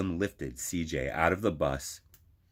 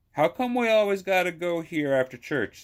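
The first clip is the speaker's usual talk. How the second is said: put-on higher, whiny little boy voice; young boy whining; 11 semitones higher than usual. Boy CJ whining to Nana about church. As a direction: play a kid who is fed up with the trip. Child CJ complains to grandmother.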